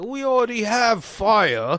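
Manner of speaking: high, loud, slow mocking comeback; unimpressed retort. Executioner mocking Jamal's fire trick, slow and loud. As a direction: drag it out, unimpressed. Deadpan retort to fake sorcerer.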